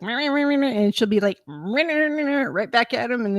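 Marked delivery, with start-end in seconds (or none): 0.0-0.7 high nonsense mimicry; sing-song smart-aleck babble; mock bickering. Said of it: "she'll smart off at him". 1.5-2.4 high sassy nonsense babble; imitated cheeky backtalk; smart-off mimicry. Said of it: "she'll smart off at him".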